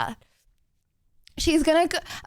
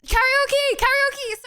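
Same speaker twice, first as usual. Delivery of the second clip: sudden call; 13 semitones higher, much slower than usual. Real, not acted.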